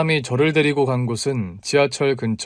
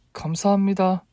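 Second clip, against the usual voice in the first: lightly higher-voiced heroine; a higher, polite thank-you; 여자 주인공의 감사 인사; 7 semitones higher than usual. A narrator's reading. 눈물 중 손수건 받은 인사. (살짝 높은 목소리의 감사 인사)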